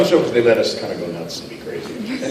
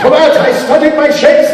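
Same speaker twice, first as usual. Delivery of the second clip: 1 mock Shakespearean; 7 dB louder than usual. Voice actor hams up Shakespeare.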